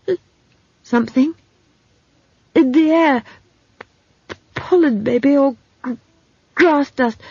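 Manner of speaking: high, halting, sticking on S and P; stammering young man. Acted. Simon explaining his allergy to Esk, stammering. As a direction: stutter through an earnest explanation.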